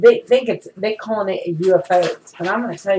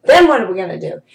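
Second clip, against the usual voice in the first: raised, higher, faster insistent reply; louder and higher, pressing the point home; retold heated reply; 5 semitones higher, 5 dB louder than usual. Telling her husband why she prints everything, raised insistent voice. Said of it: "I said".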